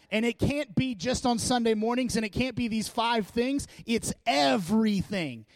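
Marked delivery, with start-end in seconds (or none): none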